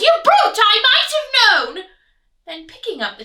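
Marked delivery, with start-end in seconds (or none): 0.0-1.9 very high, loud outraged pig; indignant piglet shriek; pig crying out. Acted. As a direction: shriek at the brute. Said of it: "Pig cried".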